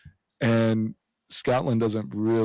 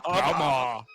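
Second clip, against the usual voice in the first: botched Scottish accent, sounding Austrian; attempted Scottish accent; 2 semitones higher than usual. Shown as a demonstration. Showing friends the problem word, failed Scottish accent. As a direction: try Scottish and slip into Arnold. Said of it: "can't say hammer with a Scottish accent".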